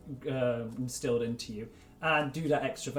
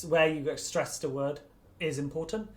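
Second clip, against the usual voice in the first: ordinary talk, nothing marked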